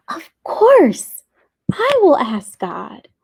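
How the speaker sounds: high, excited burst on the first words; a child's eager realization, starting high; excitedly struck by an idea. A narrator's reading. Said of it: "Nia thought with excitement".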